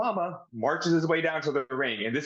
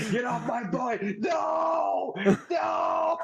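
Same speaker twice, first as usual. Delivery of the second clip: high, slow, shouted pleading; mock shriek; 8 semitones higher, much slower than usual. Mama screaming at Viscera to get off her son, high mock shriek. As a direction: shriek it like a horrified mother. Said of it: "And she goes".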